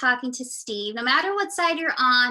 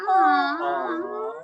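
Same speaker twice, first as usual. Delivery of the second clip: slow cooed aw; 5 semitones higher than usual. Pretend. Aw jingle again.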